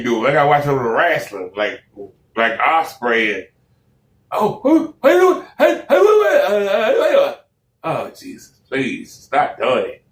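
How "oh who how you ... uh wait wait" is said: much higher, hammed-up British accent; mock a British wrestler's chatter. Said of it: "that British accent".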